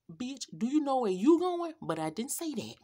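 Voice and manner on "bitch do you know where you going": higher, louder, snapping it back at him; the furious comeback she wanted to yell at the passenger; imagined angry retort. Retold or imitated. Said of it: "I started to ask".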